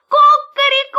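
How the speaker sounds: high, loud cock-a-doodle-doo; shrieked rooster call; rooster crowing. A narrator's reading. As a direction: crow it out at full voice. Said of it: "захлопал крыльями и закричал".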